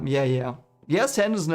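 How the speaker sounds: ordinary talk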